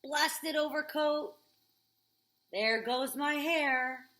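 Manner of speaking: slow, loud annoyed grumble; grumbling. Acted. Old man getting ready for rain, grumbling slowly.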